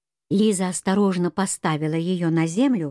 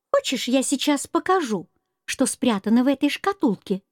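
higher little mouse voice, coaxing; squeaky mouse voice; 5 semitones higher than usual. Acted. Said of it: "пискливый голосок серой мыши".

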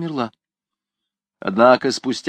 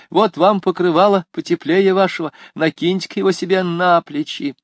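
higher, louder kindly offer; kind woman voice; 7 semitones higher, 3 dB louder than usual. A narrator's reading. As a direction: coax her gently to take it.

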